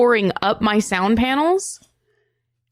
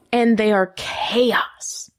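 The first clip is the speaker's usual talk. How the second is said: whisper; much slower than usual. Acted. Dramatic aside about the kittens.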